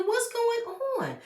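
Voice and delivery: very high, slow squeal of disbelief; retold amazed reaction. Reacting to Aunt Nora standing up, high-pitched shock. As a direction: squeal it, stretched out.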